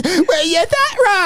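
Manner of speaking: high slow gloating voice; high slow taunt. Retold or imitated. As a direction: gloat slowly. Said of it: "He goes".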